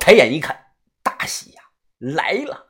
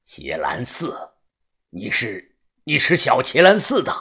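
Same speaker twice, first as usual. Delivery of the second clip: low, halting realisation; hushed surprise; 7 semitones lower, 4 dB quieter than usual. A narrator's reading. Fighter recognises opponent's temple lineage, hushed surprise. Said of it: "他突然惊讶地说道".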